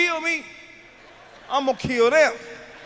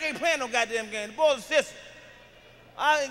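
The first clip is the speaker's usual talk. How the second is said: ordinary talk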